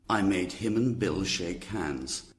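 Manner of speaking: ordinary delivery, nothing marked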